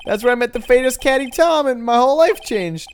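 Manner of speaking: slightly high, rambling buddy voice; earnest, goofy storytelling dude; excitable goofball pal. Acted.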